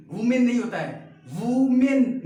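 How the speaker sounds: वुमेन hit loud with a full "men"; wrong pronunciation. Shown as a demonstration. Teacher correcting the class's mistake, loud वुमेन as "woo-men". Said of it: "गलत पढ़ते हैं".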